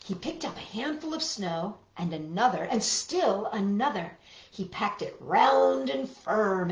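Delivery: put-on gentle read-aloud for small children; picture-book narration for little listeners; storybook reading. A narrator's reading.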